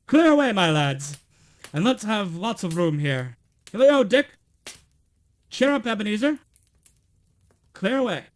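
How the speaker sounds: loud, high cheery orders; jolly shout. A narrator's reading. Fezziwig shouts cheerfully to clear the floor. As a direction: call across the warehouse.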